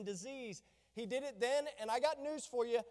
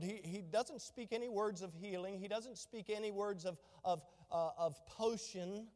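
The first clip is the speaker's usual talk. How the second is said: ordinary delivery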